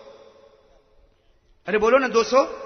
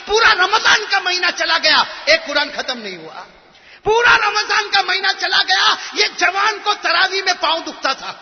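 fiery preaching; 7 semitones higher, 6 dB louder than usual. Acted. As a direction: preach it to the whole mosque. Scolding the congregation. (پرجوش خطبہ، بلند آواز میں)

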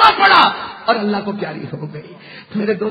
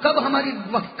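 usual speaking voice

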